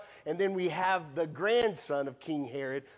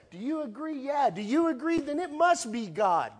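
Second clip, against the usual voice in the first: mock group consensus; 8 semitones higher, 4 dB louder than usual. Mock church crowd agreeing.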